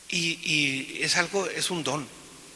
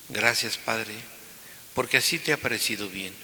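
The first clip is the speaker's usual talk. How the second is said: proclaimed Gospel reading; 3 semitones lower than usual. Acted. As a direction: proclaim it to the whole church. Proclama el Evangelio en misa. (lectura solemne del Evangelio)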